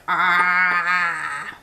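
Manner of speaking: a short throaty growl like a frightened parrot; imitated parrot growl. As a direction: growl it out the way the bird does when scared. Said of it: "she'll make this loud growling noise".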